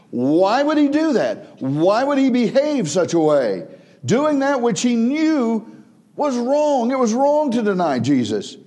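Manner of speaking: high, loud pulpit cry, pressing the question; preaching at full force. Acted. Preacher confronting the congregation over Peter's denial, fervent. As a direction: drive the point home to the congregation.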